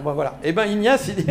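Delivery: ordinary delivery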